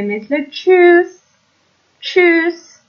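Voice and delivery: چوس said slowly, opening with a clear ch; German tsch sound. Shown as a demonstration.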